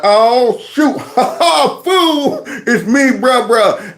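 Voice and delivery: mock hype laughing welcome; laughing excited greeting. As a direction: greet your buddy while cracking up. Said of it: "We're like".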